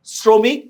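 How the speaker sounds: শ্রমিক said "sro-mik", high and rising; শ্রমিক with an S and a rounded o; O vowel shown. Shown as a demonstration. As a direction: stress the "o" in শ্রমিক. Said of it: "স এর ফলে ওকার লাগবে".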